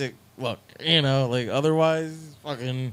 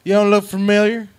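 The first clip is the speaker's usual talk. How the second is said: put-on higher, louder wary kid voice; suspicious local kid; 8 semitones higher, 9 dB louder than usual. Tattooed kid to the musician, wary and higher. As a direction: size up the stranger, higher.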